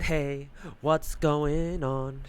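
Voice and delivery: slow, stretched-out mock hello; mock drawn-out greeting. Gamer mocking the knight's entrance, slow drawn-out hey. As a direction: drag every word out, teasing. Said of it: "I said".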